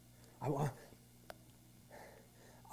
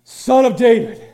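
crying out; 8 semitones higher, 15 dB louder than usual. Pretend. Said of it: "if the church begins to cry out".

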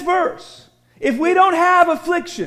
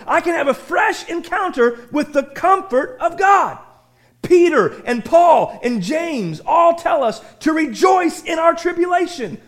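ordinary talk, nothing marked

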